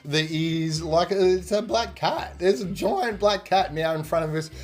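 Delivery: ordinary delivery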